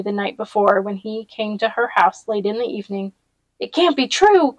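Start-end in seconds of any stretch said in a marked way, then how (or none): 3.6-4.6 higher protesting cry. A narrator's reading. A townsman crying out in protest, disbelieving. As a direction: refuse to believe it, out loud. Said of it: "someone cried out".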